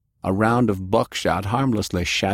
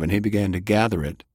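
plain reading voice

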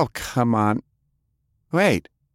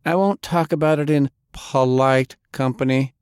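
coldly; 7 semitones higher, 3 dB louder than usual. Acted. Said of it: "Delilah said coldly".

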